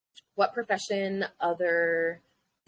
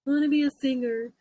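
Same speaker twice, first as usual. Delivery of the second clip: put-on little kid voice; 5 semitones higher than usual. Imitating her childhood self, higher kid voice. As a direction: sound like a little kid dreaming. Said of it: "but like little kids, right?".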